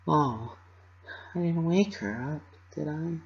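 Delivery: timid whisper, afraid of waking her; soft anxious little voice. A narrator's reading. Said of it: "she asked even softer".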